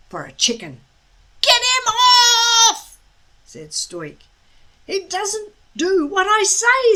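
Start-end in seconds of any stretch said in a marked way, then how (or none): 1.4-3.0 high, loud, slow panicked cry; panicked shout. A narrator's reading. Stoic with a dragon on his beard, panicked shout. 4.9-7.0 put-on higher, louder boy's voice; a helpless complaint; protesting boy. A narrator's reading. Hiccup protests to his father, lightly voiced.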